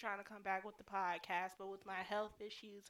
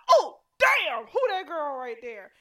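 loud, high admiring exclaim; excited; 6 semitones higher, 18 dB louder, slower than usual. Retold or imitated. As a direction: hype yourself up in the mirror. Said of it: "I'm like".

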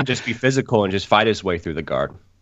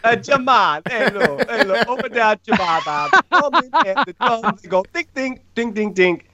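high, hammed-up Jamaican accent; a goofy Jamaican singsong, much higher; very bad Jamaican accent; 13 semitones higher than usual. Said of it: "very bad Jamaican".

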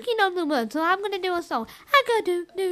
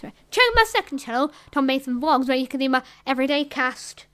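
ordinary talk, nothing marked

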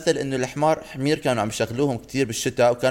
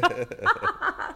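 high squealing laugh; 4 dB quieter than usual. Real, not acted. Laugh at kahkaha joke.

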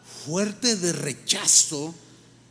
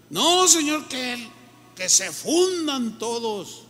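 aggressive outburst; 4 semitones higher, slower than usual. Retold or imitated. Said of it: "Qué reacción tan, tan agresiva".

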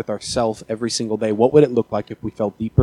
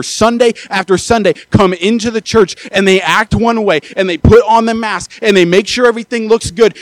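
high, loud, impassioned preacher cry; fervent pulpit climax, higher and louder; preaching at full force; 7 semitones higher, 8 dB louder than usual. Acted. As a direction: build the sermon to a breathless peak. Preacher builds to climax, then "I'm out of breath.

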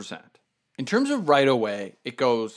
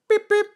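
high squeaky beep beep of a horn; imitated car horn; 5 dB louder than usual. Runner mimics the car honking behind him, squeaky horn noise.

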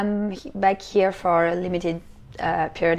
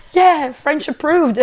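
high, louder mock cheer; proud endorsement; 7 semitones higher, 6 dB louder, slower than usual. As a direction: draw it out like a playful stamp of approval. Acting out proud French trademark.

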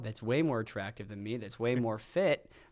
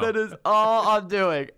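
smug gloating; 7 semitones higher, 6 dB louder, slower than usual. Retold or imitated. Mock finalist gloating.

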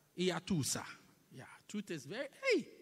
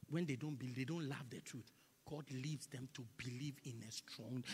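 ordinary talk, nothing marked

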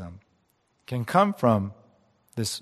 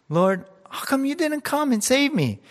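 much higher, aggrieved plea; pleading question; 12 semitones higher, 3 dB louder than usual. Retold or imitated. Drowned man asking God why he was not saved, high and aggrieved. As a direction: whine it up to the Lord. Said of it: "the man asked".

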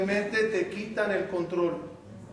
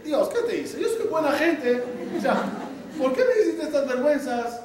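mock complaint; 6 semitones higher than usual. Retells his own complaint to God.

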